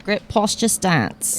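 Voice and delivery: put-on light Australian accent. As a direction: say it like an Aussie. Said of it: "you said it in Australian accent very well".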